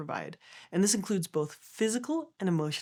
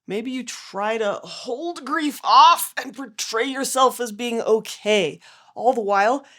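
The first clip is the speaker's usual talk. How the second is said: loud, slow, effortful delivery; demonstrated physical strain in the voice; straining under weight; 4 semitones higher, 7 dB louder, slower than usual. Shown as a demonstration. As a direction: talk while holding a heavy load at arm's length. Demonstrates holding heavy grief off.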